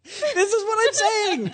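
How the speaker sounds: high, drawn-out protest through laughter; laughing exclamation. Real, not acted. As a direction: cry it out slowly, laughing. Exasperated reaction to cohost's point.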